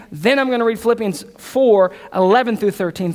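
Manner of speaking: ordinary talk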